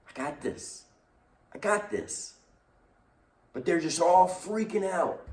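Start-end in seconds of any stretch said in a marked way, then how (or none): 0.1-2.3 softer, calm reassurance; soft calming voice of God; reassuring God. Acted. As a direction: gently settle frightened people. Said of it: "God is saying".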